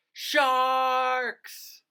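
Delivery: high, loud drawn-out alarm; shocked cry of sharks; dramatic gasp. Acted. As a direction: reveal the danger with a shriek. Dramatic danger reveal.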